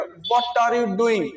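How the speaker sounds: what are you doing said very slowly, word by word; slow what are you doing right down for repeating; slow pronunciation. Shown as a demonstration. Teacher slowly modelling what are you doing for students. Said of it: "pronunciation कैसे करना".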